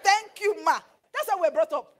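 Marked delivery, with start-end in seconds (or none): 0.0-0.8 high, loud, overly polite yes ma; a bride answering her insulting mother-in-law, sweetly loud; dutiful daughter-in-law. Retold or imitated. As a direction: sing out submissive thanks to mother-in-law. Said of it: "will tell her".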